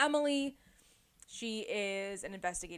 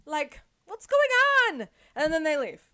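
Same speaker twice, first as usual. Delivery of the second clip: much higher and louder, incredulous; indignant; 8 semitones higher, 9 dB louder than usual. Real, not acted. Teller reacting to the yelling neighbor, high and incredulous. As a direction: sound baffled and outraged.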